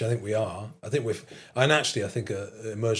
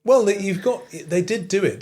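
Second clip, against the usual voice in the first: ordinary delivery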